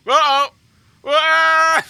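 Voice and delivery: high, loud uh-oh turning to a cry; alarmed yelp. Real, not acted. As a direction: react to something going wrong. Something snaps on stream.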